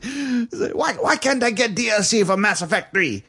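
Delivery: high, whiny whining voice; voicing Kim Jong-un. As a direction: whine it like a spoiled kid.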